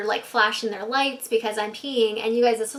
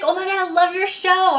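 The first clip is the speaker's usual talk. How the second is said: star-struck cop; 7 semitones higher, 7 dB louder than usual. Retold or imitated.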